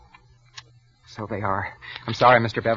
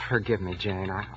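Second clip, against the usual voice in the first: quiet apology; 4 semitones lower, 9 dB quieter than usual. Acted. Apology after snapping.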